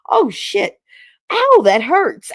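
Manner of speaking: high, loud mock yelp of pain; retold pained yelp. Retelling finding a bruise after the shower, pained yelp.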